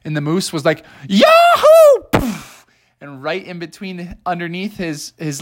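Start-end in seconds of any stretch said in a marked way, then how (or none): 1.1-2.2 very high, loud yahoo; a joyful cowboy whoop. Acted. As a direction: moose shouting for joy, very high. Moose whoops on return.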